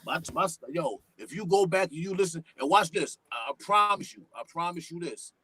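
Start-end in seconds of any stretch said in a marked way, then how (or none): none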